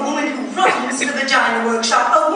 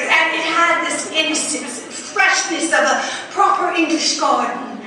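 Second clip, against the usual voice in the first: normal talking voice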